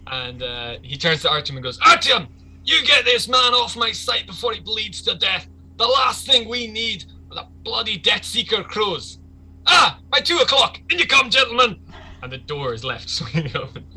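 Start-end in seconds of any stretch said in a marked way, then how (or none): 1.8-11.8 high, loud gang boss tirade; angry bellowed orders. Acted. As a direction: bellow orders at your henchman. Angry boss orders his henchman.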